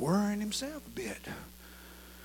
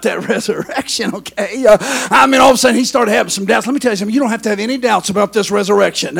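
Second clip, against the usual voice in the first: loud, driving preacher cadence; full-force preaching; 7 semitones higher, 20 dB louder than usual. Real, not acted. Preacher to congregation on resurrection and doubt, full-force preaching.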